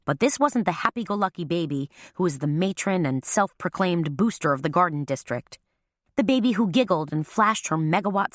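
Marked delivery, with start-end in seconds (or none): none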